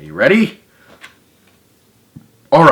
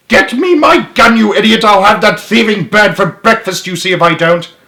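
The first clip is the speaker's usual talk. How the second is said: high, loud, blustering threat; furious shouting; 10 semitones higher, 7 dB louder than usual. A narrator's reading. Duke threatening thieving bird to gardener, furious shout. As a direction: bellow the orders in outrage. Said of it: "the Duke was shouting".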